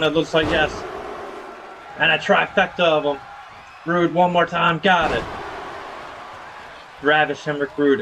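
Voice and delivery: punchy ringside commentary; wrestling play-by-play. Acted. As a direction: call each wrestling move as it lands. Calls wrestling match play-by-play.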